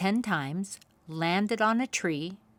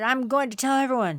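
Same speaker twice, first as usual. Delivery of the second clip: fast, higher and louder threat; a soaked beaver shouting in anger; angry shout; 6 semitones higher, 6 dB louder, faster than usual. A narrator's reading. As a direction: yell it as you storm off. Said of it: "he shouted".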